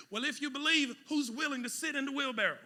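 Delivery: ordinary talk, nothing marked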